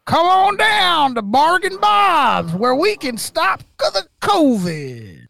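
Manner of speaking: high, slow pitchman voice; home shopping host. Pretend. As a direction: sell it like a cheesy TV ad. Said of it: "he was flat out like".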